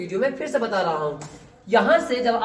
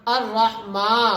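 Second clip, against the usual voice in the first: slow, syllable by syllable; measured, drawn-out teaching recitation; spelling-out recitation; much slower than usual. Shown as a demonstration. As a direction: draw out each syllable for learners. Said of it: "آج میں ہجا کر رہا ہوں".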